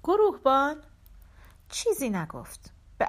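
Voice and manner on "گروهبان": raised, higher calling voice. A narrator's reading. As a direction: call out for a missing man. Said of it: "دوباره صدا زدم". (صدا زدن با صدای بلند)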